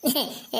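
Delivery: short embarrassed giggle. Acted. Student giggles to teacher before confessing, shy giggle.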